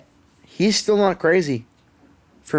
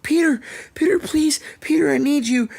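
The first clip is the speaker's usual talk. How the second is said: high, pleading damsel voice, mocked; imitating M J pleading; 11 semitones higher than usual. Gamer mimics M J begging unconscious Peter, high pleading mock. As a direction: mock-desperate plea for Peter, pitched up. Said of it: "M J's like".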